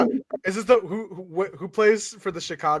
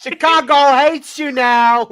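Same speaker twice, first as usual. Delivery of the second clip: mock announcement; 8 semitones higher, 10 dB louder, slower than usual. Mock Chicago threat.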